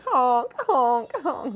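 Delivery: high honk, fading on repeats; car alarm imitation. Comedian pointing out a car alarm, imitated honking. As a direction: mimic a car horn. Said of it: "There's a car alarm going off".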